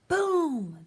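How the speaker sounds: high, loud booming shot; gunshot boom. A narrator's reading. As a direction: let the shot ring out. Gunshot in the story.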